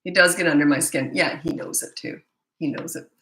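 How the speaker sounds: ordinary talk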